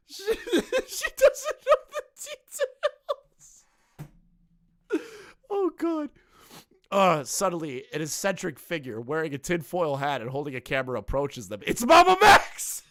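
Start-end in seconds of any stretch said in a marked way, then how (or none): 0.1-6.1 very high, wheezing through laughter; barely getting words out for laughing; helpless laughter. Real, not acted. Cracks up at the script. 11.6-12.8 high, loud, drawn-out shriek of surprise; excited yell. Real, not acted. Shocked at name in script.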